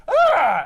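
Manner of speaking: short, high acted scream; sudden loud mock shriek. Kidnapped parents react to Venom, screaming.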